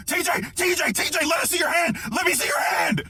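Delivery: high, loud yelling of panicked friends; imitated frantic shouting. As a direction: shout urgently to get through to someone. Friends yelling at him, acted out.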